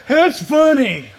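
mocking biker. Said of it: "And that guy goes".

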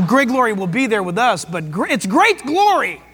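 ordinary delivery

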